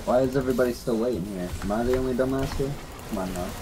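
ordinary delivery

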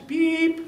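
high, sharp beep sound; imitated beep. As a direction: chirp it like a phone machine beep. Imitating the answering machine beep.

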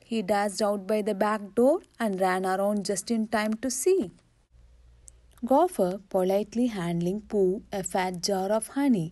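plain reading voice

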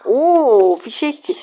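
surprised oh. Real, not acted. Opponent plays firecracker.

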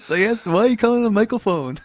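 put-on high, far childlike question; child voice. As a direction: play a puzzled little kid. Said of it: "I always thought".

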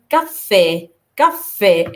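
"كافيه" with a long, held double f; doubled f. Shown as a demonstration. Teacher insisting both letters are pronounced, doubled f. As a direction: stretch the f across both syllables of "كافيه". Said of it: "لازم ننطقها مرتين".

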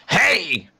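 sharp yelled hey; shout. Acted. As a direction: call out loud to get attention. Shouting at a game character.

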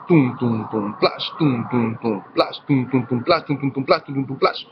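tum y plash suaves y lentos, como un baterista practicando; golpes de batería imitados. Shown as a demonstration. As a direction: imita la batería con la boca, despacio. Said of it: "los bateristas practican lento los golpes". (batería imitada con la boca, en voz baja)

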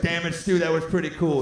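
loud mock exasperation; grudgingly impressed. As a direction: imitate a cheated girlfriend won over despite herself. Acting girlfriend won over by trick.

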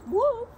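high, loud woo; effort whoop. Real, not acted. Teacher stretching with the class, high whoop. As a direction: let out a straining whoop.

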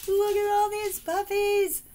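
high, loud delighted squeal over the puppies; excited squeal. Real, not acted. Opening a friend's card, squeals at the puppies. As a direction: squeal with joy at the cute pugs. Delighted at puppy cards in mail.

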